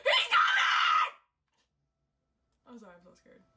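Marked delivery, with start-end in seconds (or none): 0.1-1.1 high, loud shriek with a laugh; gasping, then shrieking in fright; panicked shout. Real, not acted. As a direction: scream in real panic, laughing. Panicked while playing horror game.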